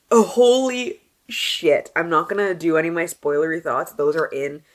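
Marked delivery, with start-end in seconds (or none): 0.1-1.9 slow awed exclaim. Real, not acted. Reacting to the book.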